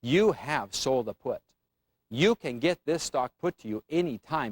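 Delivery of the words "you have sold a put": "You have sold a put." said slowly, each word weighted; slow, deliberate teacher emphasis; slow emphatic statement. Shown as a demonstration. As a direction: spell it out slowly so no one can miss it. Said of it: "you are emphatically clear on this".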